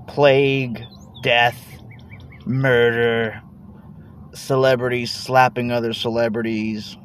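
ordinary delivery

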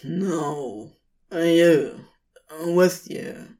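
a touch curt, tired and clipped; exhausted demon brushing off the offer, almost curt; nearly curt Crowley. A narrator's reading. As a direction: insist briefly, edge just short of sharp. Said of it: "Crowley's voice was right on the edge of curtness".

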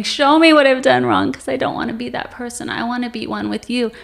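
high, pleading, starting loud then easing; retold crying plea. Woman begs God to show her wrong, acted tearful pleading. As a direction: act out a tearful appeal, easing as it goes. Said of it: "And I'm crying, and I'm saying".

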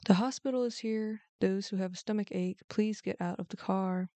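plain reading voice

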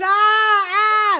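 two high, loud screams; terrified screams. Acted. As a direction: shriek as everyone flees. Everyone screams at snakes.

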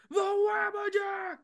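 high slow mad shout; Sheogorath. Retold or imitated. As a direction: shriek it like a mad god. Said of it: "He's, he's Sheogorath?".

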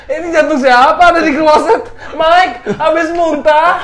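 high, loud laughing outburst; laughing shriek. Acted. Comic shocked outburst, laughing.

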